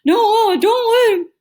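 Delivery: high, loud garbled protest; panicked mumbling. A narrator's reading. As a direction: wail through stuck lips.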